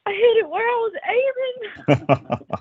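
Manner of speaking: excited. Retold or imitated. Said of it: "retelling the call to her dad".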